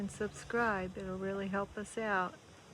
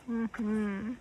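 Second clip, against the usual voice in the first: ordinary talk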